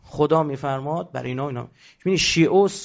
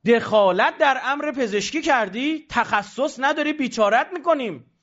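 mock official threat; 7 semitones higher, slower than usual. Health ministry threatens an amateur.

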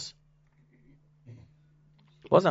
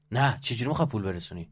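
ordinary delivery

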